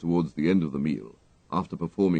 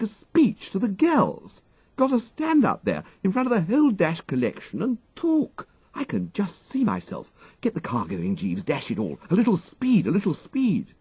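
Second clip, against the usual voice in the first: high, loud panicky toff; agitated, desperate; 14 semitones higher, 4 dB louder than usual. A narrator's reading. Wooster begs his valet to flee the speech, loud panicked toff. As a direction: babble in a frantic flap to escape. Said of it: "an agitated noise".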